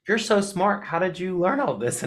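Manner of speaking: high-pitched gush of admiration; admiring patron. Retold or imitated. Gallery patron amazed at the guide's knowledge, admiring.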